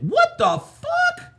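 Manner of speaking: soft, very high, drawn-out squeak; stunned disbelief. Real, not acted. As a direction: let it out small and high, aghast. Stunned reaction to message being read.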